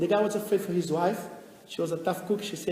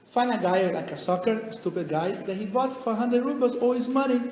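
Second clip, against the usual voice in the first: ordinary talk, nothing marked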